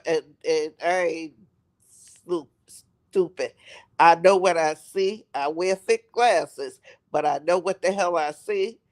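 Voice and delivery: ordinary talk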